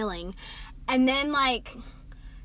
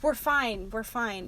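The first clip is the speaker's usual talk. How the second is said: soft murmur, fading lower; acted soothing; 4 semitones lower, 10 dB quieter, slower than usual. Wounded boy insists to the girl, soft reassurance. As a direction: repeat it gently, quieter the second time.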